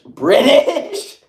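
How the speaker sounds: high, loud burst of disbelief; astonished, rising yelp; incredulous exclamation. Real, not acted. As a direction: blurt it out in amused shock. Startled by a chat guess.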